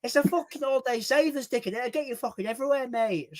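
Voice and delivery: put-on high-pitched Hull accent; pushy Hull lad doing a swap; Devo. Hull lad talking a guy out of his coat, imitated Hull accent. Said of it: "this guy on YouTube called Devo. He was from Hull".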